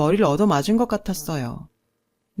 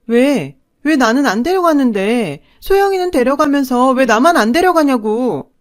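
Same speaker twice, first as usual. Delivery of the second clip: loud, higher, talking back hard; defiant shouting; 5 semitones higher, 6 dB louder than usual. A narrator's reading. Daughter shouting back at her mother, defiant and hurt. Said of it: "바락바락 대들며 소리쳤죠".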